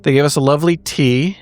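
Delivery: normal talking voice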